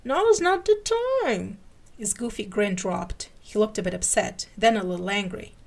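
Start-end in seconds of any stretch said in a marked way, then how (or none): 0.0-1.6 much higher, louder and slow, words half-formed; strained through a blocked mouth. A narrator's reading. Scully, mouth forced open, tells the sheriff off in a strain. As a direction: force the words out past the tooth.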